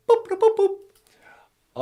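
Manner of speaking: very high beeping noises; computer beeps. Acted. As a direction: beep like an old machine.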